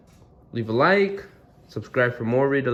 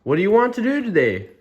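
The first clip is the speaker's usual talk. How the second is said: high, quick, bubbly asking; eager question; 11 semitones higher, 4 dB louder, faster than usual. Acted. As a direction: ask a friend excitedly, pitched way up. Piggy eagerly asks Gerald.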